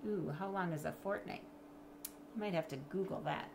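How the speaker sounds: plain reading voice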